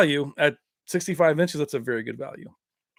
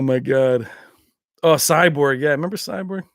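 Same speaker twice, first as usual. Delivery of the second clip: usual speaking voice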